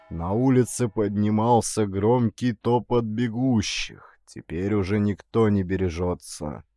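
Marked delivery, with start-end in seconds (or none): none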